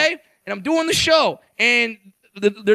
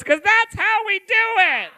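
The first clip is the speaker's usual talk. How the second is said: gleeful boast; 11 semitones higher than usual. Retold or imitated. Man in wheelchair answers why it's funny, high gleeful boast. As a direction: crow it high, as if dying laughing.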